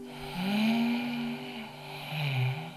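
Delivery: long slow exhaling sighs; deep relaxing sighs. Shown as a demonstration. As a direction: let the breath go with a sigh. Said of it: "Adem in".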